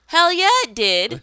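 enthusiastic. Real, not acted. Enthusiastic retort.

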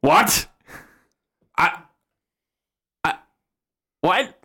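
high, halting little creature voice; stunned woodland spirit. Acted. Spirit reacts to his supposed father, high and stammering. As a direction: stammer in short, confused bursts with long gaps. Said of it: "he goes".

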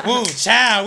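high, loud hyped cheer; hyped-up woo. Retold or imitated. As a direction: cheer it like a hype girl. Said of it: "we was both like".

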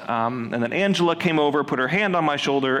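ordinary talk

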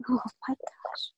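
shocked whisper. Real, not acted. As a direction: breathe it out in disbelief. Reacting to loud swallow.

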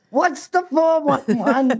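put-on slow, puzzled young person asking; lightly voiced clueless youngster; confused young person. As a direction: play the baffled youngster, drawn out. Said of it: "all the young people go".

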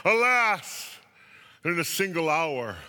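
high, loud wail on "alas"; loud, keening dramatic lament. A narrator's reading. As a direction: cry out like a theatrical mourner. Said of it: "weeping and wailing and saying".

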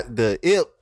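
usual speaking voice

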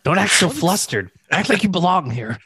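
high-pitched hammed-up pep talk; mock coaching. Acted coaching of a flustered fan.

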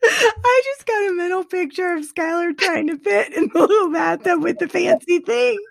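real laughter running through the words; cracking up. Real, not acted. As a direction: tell it through helpless giggles. Cracking up at mental picture.

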